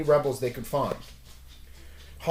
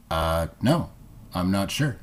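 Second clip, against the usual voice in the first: much lower, unsure alien voice; 8 semitones lower than usual. Acted. Alien admits not knowing the answer, low and unsure. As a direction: shrug it off deep and slow.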